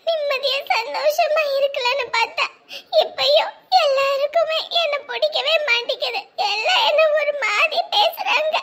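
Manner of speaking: high, sobbing complaint; girl confiding her suffering to her elder sister, weeping. Acted. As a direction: let the tears break the words. Girl weeps about her hardships.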